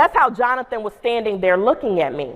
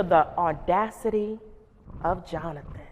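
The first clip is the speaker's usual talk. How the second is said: "the audacity of Jonathan" said much softer and lower, admiring; 4 semitones lower, 7 dB quieter than usual. Shown as a demonstration. Principal admiring the student Jonathan, softer and lower. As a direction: marvel at him, hushed and proud. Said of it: "the more my tone of voice changed".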